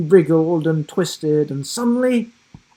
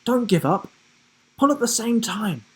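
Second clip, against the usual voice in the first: urging encouragement; 8 semitones higher, faster than usual. A narrator's reading. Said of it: "urged Rhea".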